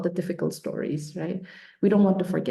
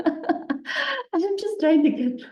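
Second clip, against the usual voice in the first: real laughter breaking through, high and slow; laughing; 10 semitones higher, much slower than usual. Real, not acted. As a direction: laugh through the words, amused. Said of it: "So I'm just finding it very funny".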